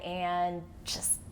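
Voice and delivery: soft whispered aside; whispering. Real, not acted. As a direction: whisper it with glee.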